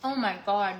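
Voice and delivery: slow, loud, delighted tasting; savoring delicious food. Real, not acted. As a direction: savor every bite out loud. Tasting the steak.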